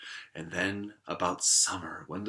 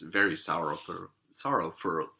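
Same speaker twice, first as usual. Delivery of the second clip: usual speaking voice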